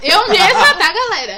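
loud, high, laughing; laughing loud joke. Real, not acted. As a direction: announce it through laughter. Joking self-claim, loud laughing.

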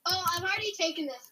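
usual speaking voice

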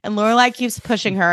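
usual speaking voice

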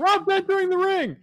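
high, excited mock commentator; wrestling announcer. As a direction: call a surprise ring entrance. Mock wrestling commentary on Rob's entrance.

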